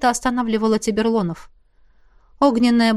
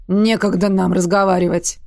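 grumbling; 3 semitones lower than usual. A narrator's reading. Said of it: "проворчал Рурк".

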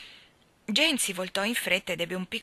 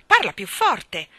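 louder, slow, impatient order; 4 semitones higher, 9 dB louder, slower than usual. A narrator's reading. Seal tells the whispering seal to speak up, loud. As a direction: demand it over the noise.

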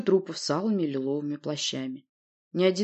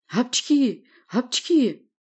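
two high, loud acted sneezes; repeated high "apchkhi"; double sneeze; 7 semitones higher, 5 dB louder, much slower than usual. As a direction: sneeze twice explosively. Allergic sneezing fit.

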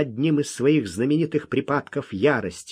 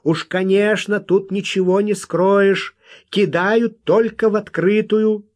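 higher, louder pressing objection; stubborn retort; 8 semitones higher, 5 dB louder than usual. A narrator's reading. Kid accusing Wild man of open scheming, stubbornly. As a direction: keep arguing, raised pitch and volume. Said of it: "упрямо возразил малыш".

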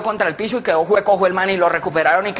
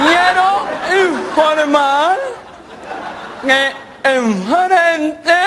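very slow, high, word by word; laboured caller message; 7 semitones higher, much slower than usual. Acted. Caller telling the barracks about a dead cow, painfully laboured. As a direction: strain out each word with long pauses.

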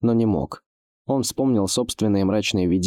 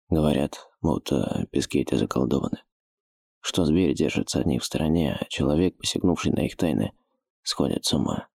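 low, hushed thief voice; a hint of a wary old thief; 5 semitones lower than usual.

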